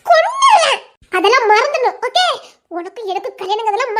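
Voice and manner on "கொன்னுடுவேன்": loud playful shout of a threat; mock threat. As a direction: bark the threat with a laugh behind it. Threatening him in a quarrel.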